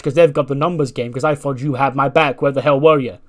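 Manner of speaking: heated, loud tirade with punched openings; angry confrontation. Acted. Wrestler berating ally who didn't back him up, angry. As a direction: rattle off grievances accusingly.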